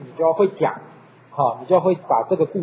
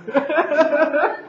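laughing; 10 semitones higher than usual. Real, not acted. Laughs at own story.

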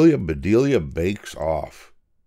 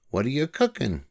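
high, cheerful storybook voice for Amelia; Amelia Bedelia voice; 7 semitones higher than usual. A narrator's reading. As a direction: lift into a bright girlish character voice.

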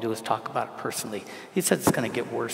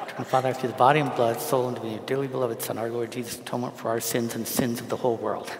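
memorised prayer rattled off without pauses; fast recitation; faster than usual. Shown as a demonstration. Priest reciting from memory so he won't lose it, rapid-fire. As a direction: run the phrases together in a quick rote stream. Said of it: "if I don't say it fast".

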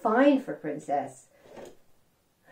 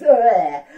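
disgusted ugh; 13 dB louder than usual. Acted. Disgust at slugs.

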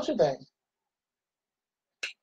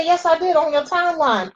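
coworker's reply; 9 semitones higher than usual. Retold or imitated. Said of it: "He said".

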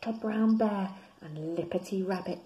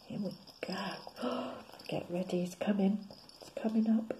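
softer, hushed build-up; suspense whisper; 6 dB quieter than usual. A narrator's reading. Reader hushes listeners as the frog comes up. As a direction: lean in and hush the children.

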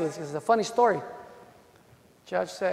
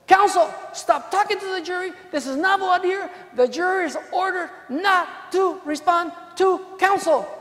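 stern judge; 8 semitones higher than usual. Retold or imitated. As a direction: rebuke counsel from the bench, slowing the last order. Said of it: "this is what the freaking judge says".

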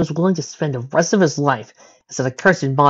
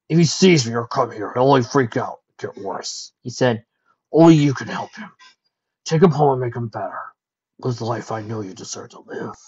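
resigned dragon. Acted. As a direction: urge her to go, holding sorrow in. Spike releases Rarity.

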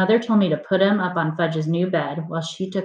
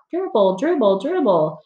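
high, read-aloud shouted chant; shouting kids; 7 semitones higher, 3 dB louder than usual. A narrator's reading. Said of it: "they started to shout".

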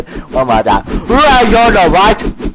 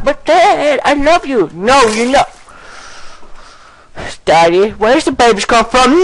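put-on child voice; 9 semitones higher than usual. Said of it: "Why are you talking like that?".

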